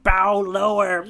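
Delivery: slow, drawn-out haughty command; mock pompous villain; cartoon villain command. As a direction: order them to bow like a cartoon villain. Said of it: "the cartoonish thing of".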